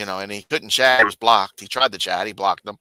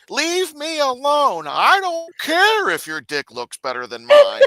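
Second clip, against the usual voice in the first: much higher, slow, whiny exasperation; mock exasperated; 3 dB louder, slower than usual. [name] telling Harvey to leave him alone, high slow whine. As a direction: whine it out like you are fed up.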